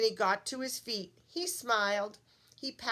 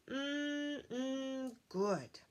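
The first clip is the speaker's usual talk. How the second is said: soft contented yummy hum; 4 semitones higher, 4 dB quieter than usual. Acted. Hungry thing eating.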